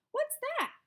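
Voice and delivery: put-on squeaky little kid asking; a curious kid piping up; high child voice. A narrator's reading. Child asking her teacher, high and piping. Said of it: "asked Jackie".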